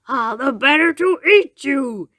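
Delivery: wolf dropping the act to pounce; snapping wolf. A narrator's reading. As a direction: snap it out hungrily, in character. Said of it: "he snapped".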